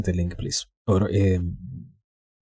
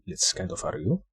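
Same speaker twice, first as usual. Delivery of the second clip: ordinary delivery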